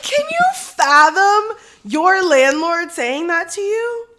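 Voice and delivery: high, loud, slow disbelief; incredulous. Real, not acted. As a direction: stretch it out in outraged amazement. Appalled at landlord's insult.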